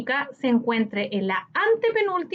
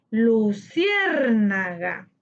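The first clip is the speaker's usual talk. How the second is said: "luciérnaga" very slow, long stressed CIÉR; much slower than usual. Shown as a demonstration. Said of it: "su sílaba tónica en cier". ("luciérnaga" muy lento, alargando CIÉR)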